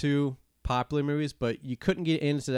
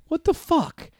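high-pitched, louder exasperated yelp; mock outrage; 5 dB louder, slower than usual. Fan reacting to a stupid movie ending, high mock outrage. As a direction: relive the outrage, voice shooting up. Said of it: "I was so mad".

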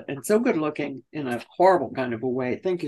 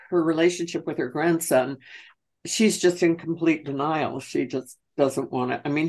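ordinary delivery

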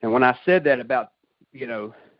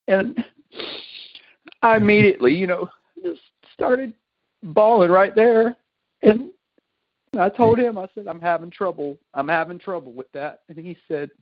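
choked up; 8 semitones higher, 3 dB louder than usual. Real, not acted. Reliving the moment he broke down.

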